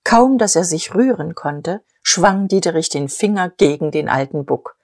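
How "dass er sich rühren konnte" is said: ordinary delivery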